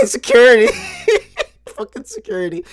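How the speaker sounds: words squeezed out through real high laughter; laughing. Real, not acted. Telling listeners about security, laughing hard.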